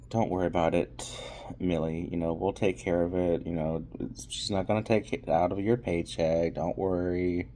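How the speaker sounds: calm her down gently; mock reassurance. [name] reassures Millie.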